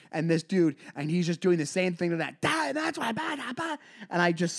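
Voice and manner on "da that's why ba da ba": mock chatter. Mimicking host's chatter again.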